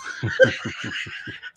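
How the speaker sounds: breathless laugh of triumph; gleeful laugh. Real, not acted. After the guilty verdict, a delighted laugh. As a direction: let the joy spill out.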